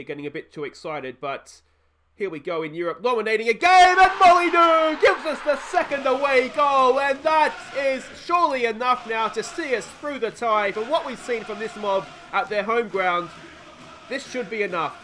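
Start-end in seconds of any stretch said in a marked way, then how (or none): 3.0-15.0 high, loud, laughing cheer; elated shout. Real, not acted. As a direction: roar it out like a fan in the stands. Celebrating second away goal.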